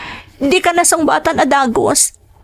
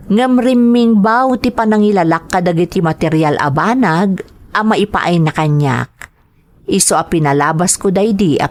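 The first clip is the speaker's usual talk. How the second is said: calm, lower reflective narrator voice; story narration; 9 semitones lower than usual. A narrator's reading. Woman recalling her marriage to Arman, narrated evenly. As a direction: tell her story looking back, evenly.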